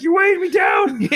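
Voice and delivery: high, loud complaint; mock protesting yell. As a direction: imitate a buddy hollering for relief. Friend's retold protest while swimming.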